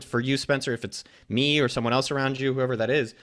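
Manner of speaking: ordinary talk, nothing marked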